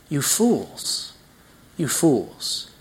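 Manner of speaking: soft, understated scorn; derisive. Acted. Preacher voices God mocking kings who think themselves supreme, quietly derisive. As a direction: let the derision fall quietly on each repeat. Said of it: "he holds them in derision".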